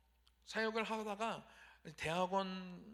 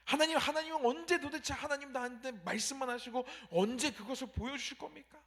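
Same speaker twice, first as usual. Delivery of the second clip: higher pitched exasperated plea; pleading with rising complaint; complaining prayer; 5 semitones higher, 5 dB louder than usual. Retold or imitated. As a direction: protest to God, asking why. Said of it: "이렇게 얘기할 수도 있습니다".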